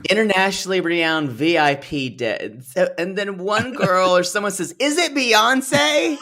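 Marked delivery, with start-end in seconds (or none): 4.8-6.2 high, drawn-out squeal of hope; hammed-up excited guess, close to laughing; mock excitement. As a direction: mimic a gullible, thrilled fan. Mimics contestant's hopeful guess, laughing.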